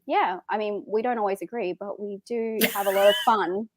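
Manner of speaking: normal talking voice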